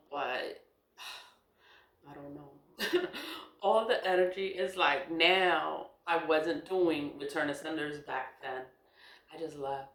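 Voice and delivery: usual speaking voice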